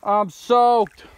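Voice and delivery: loud, high soaked cry; drenched and cold. Real, not acted. Just fell in water.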